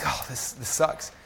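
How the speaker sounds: slow, deflated retold groan of defeat; discouraged. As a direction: say it slowly, mildly dejected. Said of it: "I was discouraged".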